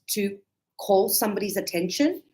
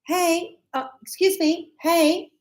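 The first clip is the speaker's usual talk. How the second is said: 1 calling a waiter; 6 semitones higher, slower than usual. Shown as a demonstration. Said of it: "So sometimes I go".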